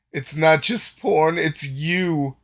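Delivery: high, loud, drawn-out needling; hammed-up teasing reveal; gleeful taunting. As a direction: stretch it out as a scandalous discovery. Delgar taunts Gain with realization.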